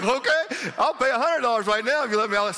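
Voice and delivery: mock-frantic plea to be released; hammed-up eager giving in; eager surrender. Caving in to the guard.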